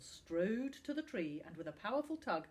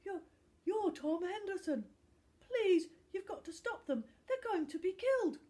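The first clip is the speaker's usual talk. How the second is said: put-on high, loud, frantic boy's plea; young boy pleading with his hero to help; desperate boy; 13 semitones higher, 8 dB louder than usual. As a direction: beg him like a scared kid. Frightened boy begs the footballer.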